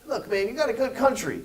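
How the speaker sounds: high, loud straight talk to a sulking leader; frank buddy talk. Retold or imitated. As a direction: talk sense into him like a fed-up friend. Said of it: "never get a chance to go".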